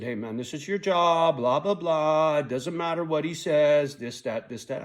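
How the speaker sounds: mock the boss waving it away with blah blah blah; imitating the boss dismissing his complaint; dismissive boss. Boss quoted brushing off complaint.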